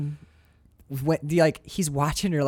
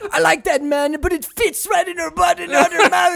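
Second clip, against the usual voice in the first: ranting director; 9 semitones higher, 3 dB louder than usual. Pretend. Director voiced in bit.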